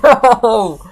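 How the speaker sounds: high, loud laughing "No!". Real, not acted. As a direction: burst out laughing in disbelief. Friend says he doesn't understand.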